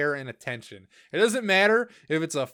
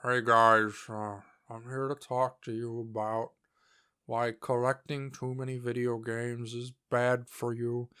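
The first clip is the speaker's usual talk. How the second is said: lower, droning mock of a dull YouTuber intro; mocking low-energy YouTuber; 3 semitones lower, 3 dB quieter than usual. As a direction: parody him as a sleepy guy talking at the camera.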